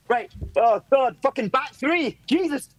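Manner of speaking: high-pitched frantic mock manager; panicking manager. Manager flapping over formations in training, frantic high voice. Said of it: "every session he's going".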